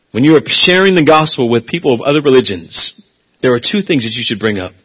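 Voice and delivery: usual speaking voice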